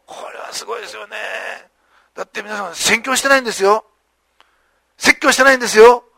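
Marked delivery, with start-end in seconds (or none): 0.1-1.2 a hushed, breathless aside; whispered awe. Real, not acted. Preacher marvelling to the congregation in a whisper. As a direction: lean in and whisper the wonder.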